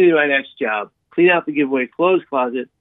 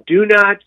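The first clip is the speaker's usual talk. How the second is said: "do not" hit higher and louder; urgent stress; 6 semitones higher, 5 dB louder than usual. Real, not acted. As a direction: plead it: "do not". Urgent advice on suicide.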